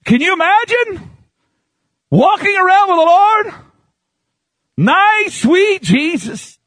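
high, forceful pulpit cry; fiery preaching. Real, not acted. Preacher hammering Jesus's words to the congregation, fiery. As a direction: preach it out, pitched high and driven.

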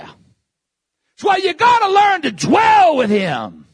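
high, loud preacher's exhortation; pleading, loud pulpit cry; fiery preaching. Real, not acted. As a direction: drive it home at the top of your voice. Preacher rises to full force.

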